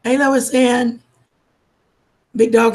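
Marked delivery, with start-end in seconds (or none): none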